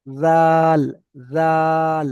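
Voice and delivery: "জাল" with a light, thin Z and long A; thin pronunciation. Shown as a demonstration. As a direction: say "জাল" thinly, drawing out the vowel. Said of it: "পাতলা করে উচ্চারণ হবে". (পাতলা করে "জাল")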